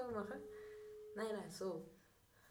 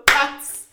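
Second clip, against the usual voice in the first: loud splat sound; 6 semitones higher, 18 dB louder than usual. Retold or imitated. Imitating a fall sound.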